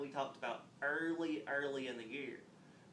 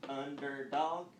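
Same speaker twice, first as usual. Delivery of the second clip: UN-DER-DOG, slow and firm; tapped-out syllables; 6 dB louder, much slower than usual. Shown as a demonstration. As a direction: tap out "Underdog" in three separate, louder beats. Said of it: "We can tap it out".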